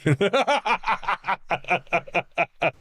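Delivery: high giggling laugh. Real, not acted. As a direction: break up in giggles. Laughing at the accent joke.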